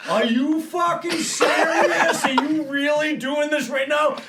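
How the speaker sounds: high, slow, laughing disbelief; can't believe what he's doing; incredulous. Retold or imitated. Firemen incredulous.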